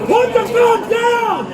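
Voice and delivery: shouting. Real, not acted. Officer orders armed woman.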